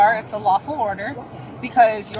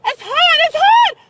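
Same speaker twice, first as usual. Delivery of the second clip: high shrieks of pain; pained screaming; 6 dB louder than usual. Real, not acted. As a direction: shriek that it burns. Burning on the hot concrete.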